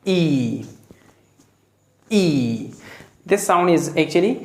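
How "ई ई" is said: long drawn ee vowel. Shown as a demonstration. Teacher modelling the long ee vowel for students. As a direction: hold the ee sound long. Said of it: "ये है".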